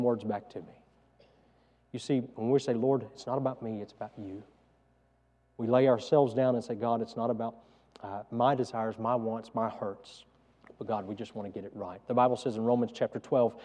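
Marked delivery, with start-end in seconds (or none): 2.7-4.4 much softer, humbled prayer; hushed prayer. Pretend. A prayer of surrender quoted. 6.7-12.0 much softer, humbled prayer; hushed prayer. Pretend. A prayer of surrender quoted.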